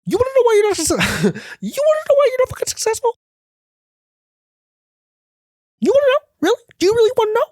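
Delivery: very high, fast hype-man voice; Gary Vee impression. Mocking a motivational speaker with a very high, fast hype voice. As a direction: ham up a pushy motivational guru. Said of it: "This is some real Gary Vee shit".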